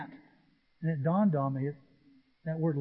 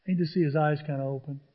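normal talking voice

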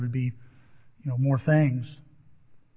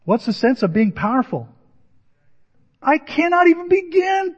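earnest bodybuilder; 9 semitones higher, 3 dB louder than usual. Retold or imitated. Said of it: "And that guy goes".

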